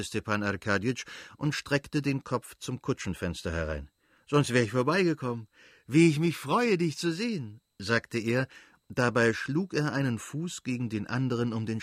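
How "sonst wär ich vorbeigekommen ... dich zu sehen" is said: delighted greeting. Acted. Stepan greets brother-in-law gladly.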